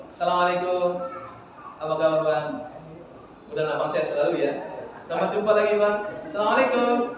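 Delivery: smiling greeting. Shown as a demonstration. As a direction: greet him with a long smile. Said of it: "Sebelum jumpa udah senyum". (salam sambil tersenyum)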